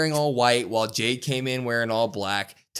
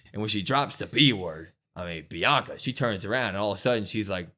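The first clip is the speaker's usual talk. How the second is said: ordinary delivery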